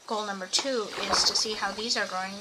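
ordinary talk, nothing marked

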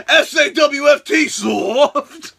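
loud spelled-out shout; Enzo shout. Retold or imitated. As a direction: bark each letter out. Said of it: "Enzo jumps up".

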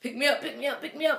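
high, repetitive mock plea; urgent little high-pitched begging, imitated; imitated pleading baby. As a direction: plead like a baby wanting to be held. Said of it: "had his hands up like".

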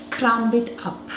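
ordinary talk, nothing marked

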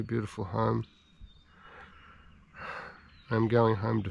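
quiet, soft, choked-up musing; holding back tears while speaking; emotional. Real, not acted. Alone in the bush, telling viewers about his dying dog, softly emotional. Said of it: "I'm feeling a little bit emotional".

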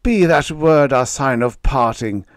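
high, loud shriek; a loud, high-pitched shriek. Acted. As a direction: cast the fiend out at full voice. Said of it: "I shrieked".

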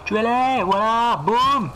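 high excited commands; shouting orders. Pretend. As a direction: bark orders at the archers. Ordering archers to fire.

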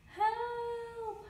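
very high, drawn-out holler; hollered cry for help. A narrator's reading. Helpless Billy hoisted by big brother, hollering. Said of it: "hollers helpless Billy".

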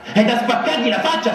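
high shrill outburst; shrieking. A narrator's reading. Ferfechin shrieking at the narrator, outraged. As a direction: screech it in outrage. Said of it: "strillò Ferfechin".

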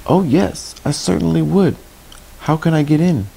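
put-on high-pitched eager puppet boy; high, eager little character voice; eager Pinocchio. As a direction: pipe up high, keen to get in.

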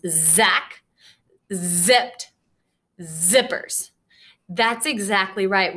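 usual speaking voice

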